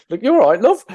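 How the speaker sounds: caring passer-by checking on a struggling runner, in a mock South London accent; poor South London accent. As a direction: call out like a South London lady in the park, a bit overdone. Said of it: "my poor attempt at a South London accent".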